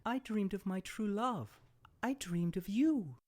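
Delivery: high, tender princess voice; dreamy and loving. Acted. Princess telling the prince, high tender voice.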